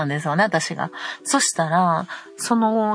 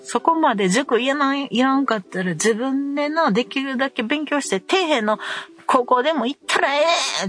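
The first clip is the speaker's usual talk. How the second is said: higher, heated scold; angry scolding; 7 semitones higher than usual. Retold or imitated. Mother scolding her son mid-fight, angry. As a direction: let him have it.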